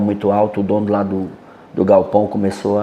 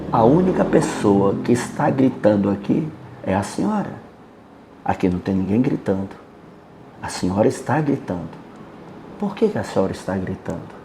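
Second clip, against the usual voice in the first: much softer, steady and unhurried; calm lowered voice; 3 semitones lower, 6 dB quieter than usual. Retold or imitated. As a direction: quietly show her she is the one shouting. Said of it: "baixei realmente o tom de voz". (voz baixa, calma e firme)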